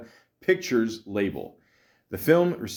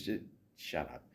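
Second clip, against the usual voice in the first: much softer dismissive aside; muttered aside; 4 semitones lower, 15 dB quieter than usual. Real, not acted. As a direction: throw it away under your breath. Dismissing critics under breath.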